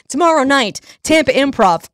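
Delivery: usual speaking voice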